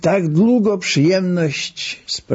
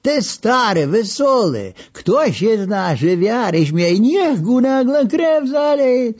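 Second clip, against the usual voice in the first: put-on high, slow old Lwów man insisting; old Lwów man calling; 12 semitones higher than usual. Old man with a beet nose demands cheerful songs, high and slow. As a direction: raise the pitch and drag it out like a tipsy old man. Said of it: "zawołał ten z buraczanym nosem".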